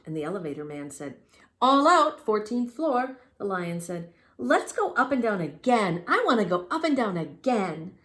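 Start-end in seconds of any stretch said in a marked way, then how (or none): 1.6-3.2 loud high announcing; elevator operator call. Acted. Elevator man calls. 4.4-8.0 loud pleading cub; eager young lion. Acted. Lion begs.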